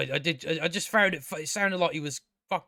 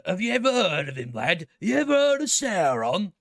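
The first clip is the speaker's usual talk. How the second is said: put-on, throat-straining character voice; exaggerated movie-trailer villager impression; hammed-up trailer character; 3 semitones higher, 4 dB louder than usual. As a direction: force out a theatrical voice that strains the throat. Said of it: "I shouldn't have spoke like".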